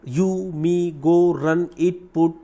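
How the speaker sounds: ordinary talk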